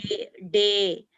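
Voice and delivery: drawn-out डे, said slowly and clearly; slowly modelling the long e sound; slow sound demo. Shown as a demonstration. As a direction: stretch out डे so the child hears the vowel. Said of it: "जैसे मे".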